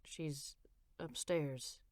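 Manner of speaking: low, soft and halting, lightly voiced husband; husband breaking bad news to his wife, hesitant and soft. A narrator's reading. Faltering low husband voice. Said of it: "he said hesitantly".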